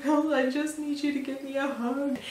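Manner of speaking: high-pitched imitation of emotional pleading; mock tearful plea. Pregnant woman begs her husband for comfort, mock tearful plea. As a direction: act out a weepy, needy request, pitched well up. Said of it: "crying and laughing and snorting at the same time".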